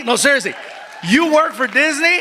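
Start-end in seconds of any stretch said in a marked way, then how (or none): 1.1-2.2 impressed disbelief. Retold or imitated. Repeated question, impressed by Disney.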